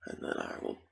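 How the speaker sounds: hushed, mostly voiceless game commentary; ASMR whisper. Real, not acted. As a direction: whisper casual gameplay chatter to the listener.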